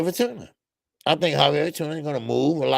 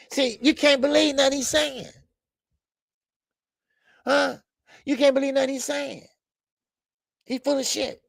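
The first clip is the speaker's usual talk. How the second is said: incredulous scoff; 10 semitones higher than usual. Real, not acted. Mocking a boxer's claims.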